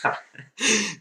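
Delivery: a high, giggling laugh; squeaky laugh of disbelief. Real, not acted. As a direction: let a real laugh escape. Laughing at the pick.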